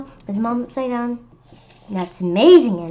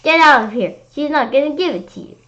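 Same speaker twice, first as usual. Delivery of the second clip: yelling; 6 semitones higher, 6 dB louder than usual. Acted. Kid keeps yelling.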